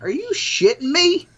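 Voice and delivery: high, incredulous outburst; exasperated disbelief. Retold or imitated. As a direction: voice total disbelief at the kid. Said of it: "I was like".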